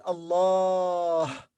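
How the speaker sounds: "Allah" with a drawn-out second a; long vowel. Shown as a demonstration. Teacher shows the unwritten alif, "Allah" with a long final a. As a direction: stretch the last vowel of "Allah". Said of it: "long vowel".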